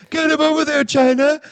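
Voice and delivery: high-pitched squeaky yell; mock young wrestler barking orders way up high; Triple H yell impression. Mocking young Triple H yelling to China at ringside, high squeaky voice. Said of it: "Does he talk like this when he yells?".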